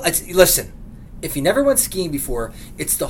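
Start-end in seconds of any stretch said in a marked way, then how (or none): none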